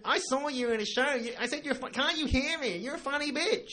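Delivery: put-on drunk bogan voice. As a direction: heckle like a drunk woman on the street. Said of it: "And then she went".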